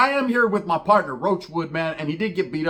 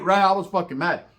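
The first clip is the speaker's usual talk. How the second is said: usual speaking voice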